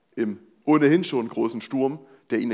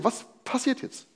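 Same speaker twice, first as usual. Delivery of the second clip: curious; 11 semitones higher, 5 dB quieter than usual. Real, not acted. Preacher builds suspense.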